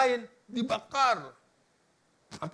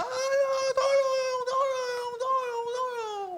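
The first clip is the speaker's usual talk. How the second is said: acted high, drawn-out cry for help; teriak minta tolong; 4 dB louder, much slower than usual. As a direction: wail it like someone drowning. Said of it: "Dia teriak". (jeritan panjang orang tenggelam minta tolong)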